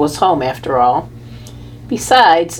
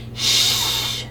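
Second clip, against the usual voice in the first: soft breathy shush; whispered shush; 8 dB quieter than usual. A narrator's reading. Boy hushing his sister to not scare the hamster, soft breathy shush. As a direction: hush them without a voice. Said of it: "said Willie".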